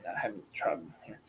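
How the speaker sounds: fast, whispered self-mockery; whispered aside. Real, not acted. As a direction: mutter it under your breath, quickly. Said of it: "Really, I'm".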